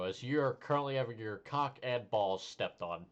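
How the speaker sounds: normal talking voice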